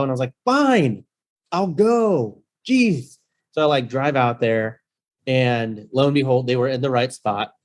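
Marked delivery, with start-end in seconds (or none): 0.4-3.2 high-pitched, fed-up sigh of a reply; exasperated giving in. Retold or imitated. Host giving in to guests who insist, exasperated. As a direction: give in like it's a huge chore.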